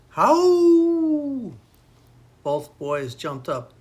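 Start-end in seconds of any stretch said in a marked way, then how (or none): 0.1-1.5 high, loud drawn-out howl; animal howl. A narrator's reading. A howl frightens two boys sleeping outside, high loud howling. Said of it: "there was another howl".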